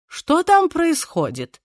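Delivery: alarmed high slow question; startled by the shouting, ask slowly; surprised. Acted. Shouts heard nearby.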